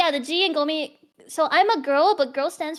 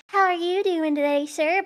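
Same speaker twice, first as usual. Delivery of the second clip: put-on higher-pitched sweet Southern accent; cutesy southern belle; 5 semitones higher than usual. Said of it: "southern belles where they talk all cutesy".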